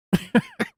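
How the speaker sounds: short squeaky laugh; a sudden high giggle; high burst of laughter. Real, not acted. Laughing at the clip.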